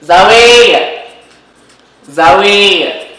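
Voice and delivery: loud call across the house. Real, not acted. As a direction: shout the name to another room. Calling someone from another room.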